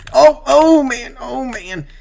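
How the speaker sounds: drawn-out loud "oh man", pitched high; startled by a near crash; loud, slow gasp of shock; real alarm. Real, not acted. Near wreck while racing.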